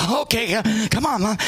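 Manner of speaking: high, mock-urgent coaxing; strained high-pitched urging; anxious hurrying. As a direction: imitate a child trying to rush mom along. Acting out hurrying his mother along.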